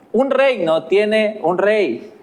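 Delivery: normal talking voice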